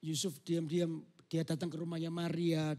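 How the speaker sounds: usual speaking voice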